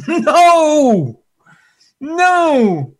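loud, high cry of no; emphatic no. Real, not acted. Preacher loudly rejecting the vengeful picture of the Lord. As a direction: shout down the idea. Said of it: "emphatic denial".

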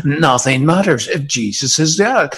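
usual speaking voice

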